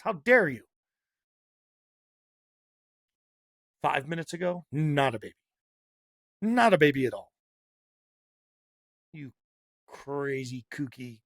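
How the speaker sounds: loud outraged demand. Real, not acted. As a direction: hammer it home, indignant.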